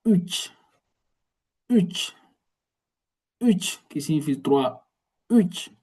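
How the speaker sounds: repeating uch clearly for the class; Turkish word üç. Shown as a demonstration. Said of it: "On va dire uch".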